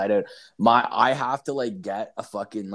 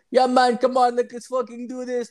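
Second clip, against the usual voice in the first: imitating a Jamaican gamer, much higher and hyped; Jamaican accent; 12 semitones higher, 4 dB louder than usual. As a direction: do a Jamaican teammate, loud and eager. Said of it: "this Jamaican guy".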